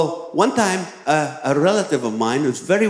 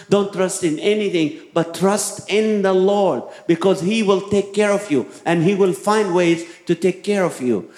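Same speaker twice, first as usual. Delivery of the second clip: high, fervent exhortation; preaching; 5 semitones higher than usual. Acted. Pastor urges the congregation to trust the Lord, fervent preaching. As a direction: preach it, driving each don't home.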